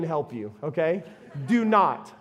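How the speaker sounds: normal talking voice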